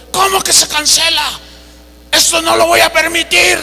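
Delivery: high-pitched mock outrage; indignant protest. Famous preacher told his flight is cancelled, imitated indignant protest. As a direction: act out an indignant traveler refusing to accept it.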